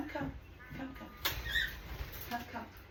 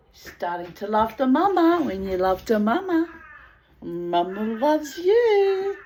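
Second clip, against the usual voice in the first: pet talk; 4 semitones higher, 7 dB louder than usual. Real, not acted. Owner praising her parrot for climbing on the glove, doting. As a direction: coo lovingly at the bird.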